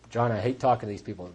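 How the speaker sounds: low, quick, soft complaint; reluctant grumble. Retold or imitated. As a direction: grumble it under your breath. Reluctant newcomer grumbles to his sponsor.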